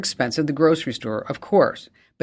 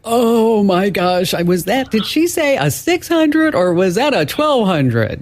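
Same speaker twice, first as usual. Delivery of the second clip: higher, slow, flustered caller voice; 7 semitones higher, slower than usual. Retold or imitated. Host imitating a caller who missed the model numbers, flustered. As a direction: play a listener frantically trying to recall model numbers. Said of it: "you're gonna be like".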